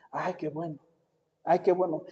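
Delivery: relieved parents breathing out; relieved sigh. Retold or imitated. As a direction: let the worry drain out.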